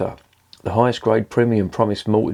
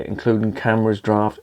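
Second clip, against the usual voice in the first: usual speaking voice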